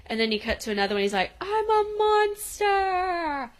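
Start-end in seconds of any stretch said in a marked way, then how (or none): 1.4-3.5 high, drawn-out mock monster; playful monster impression, pitched way up; kid's monster voice. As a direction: stretch it out like a kid playing spooky. Imitating kid playing monster.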